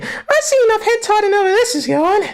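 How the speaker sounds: high squeaky character voice; Kurapika quote. Retold or imitated. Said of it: "Kurapika with her".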